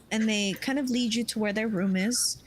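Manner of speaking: ordinary delivery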